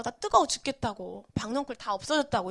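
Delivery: ordinary delivery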